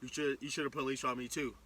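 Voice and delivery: low, soft dog voice; dog's voice. Retold or imitated. Voicing the dog teasing his owner, low and soft. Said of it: "starts wagging his tail like".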